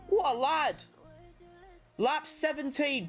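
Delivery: ordinary talk, nothing marked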